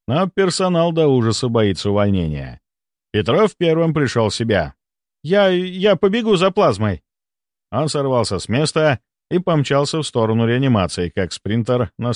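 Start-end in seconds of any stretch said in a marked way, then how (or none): 5.2-7.0 high, hasty stammered volunteering; flustered offer. A narrator's reading. A shaken doctor offers to run for plasma, flustered. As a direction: stumble on the first word, pitch up.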